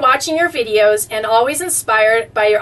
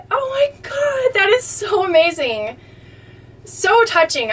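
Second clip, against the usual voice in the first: high gasp of amazement; amazed squeal; 7 semitones higher than usual. Real, not acted. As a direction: squeal with wonder. Marvels again at picture.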